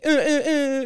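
high staccato air horn blasts; air horn imitation. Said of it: "air horn gimmick".

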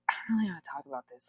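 fast, soft, near-whispered quote; hushed and hurried, imitating reluctance; reluctant mumbled aside. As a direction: rush it out under the breath, reluctantly. Retelling own reluctance in therapy.

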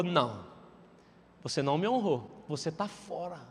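low, soft, final verdict from God; lightly voiced stern God, dropping low. Preacher voicing God telling Moses he's out, low and soft.

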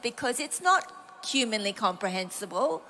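ordinary delivery

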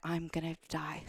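half-dead confession, quietly; exasperated mutter. Retold or imitated.

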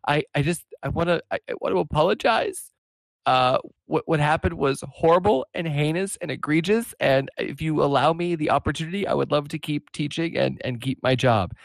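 halting, hammed-up weepy voice; tearful retold apology. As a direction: plead tearfully, imitating a crying teacher. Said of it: "she's, like, crying".

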